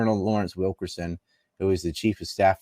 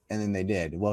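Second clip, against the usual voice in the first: ordinary talk, nothing marked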